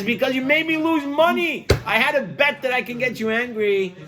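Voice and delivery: whiny complaint. Acted. The loser complains about his bet.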